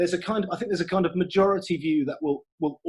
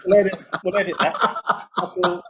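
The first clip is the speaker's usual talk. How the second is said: laughing; 3 semitones higher, 5 dB louder, faster than usual. Real, not acted. Joking about editing it out.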